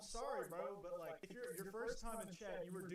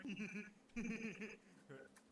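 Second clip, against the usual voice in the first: drawn-out, higher-pitched giggle; real giggling; 4 semitones higher than usual. Real, not acted. Streamer tickled by a silly appeal, long giggle. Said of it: "the point that I was giggling".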